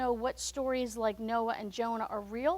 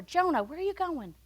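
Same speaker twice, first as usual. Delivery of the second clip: higher, louder calling out to a runaway; calling God's voice; 6 semitones higher, 4 dB louder than usual. Retold or imitated. The Lord calling after fleeing Jonah, raised voice. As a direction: call after him, pointing the way. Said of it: "I could hear it over and over".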